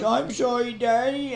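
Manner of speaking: put-on high, pitiful small-child voice; high-pitched toddler apology; little daughter apologizing. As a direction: imitate a sorry little girl. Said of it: "she goes".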